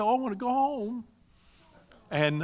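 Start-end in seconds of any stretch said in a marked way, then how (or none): 0.0-1.0 imitated tearful longing. Young Ghanaian woman answering a greeting from home, imitated tearfulness. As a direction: imitate a homesick woman, high and plaintive. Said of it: "She just began to cry".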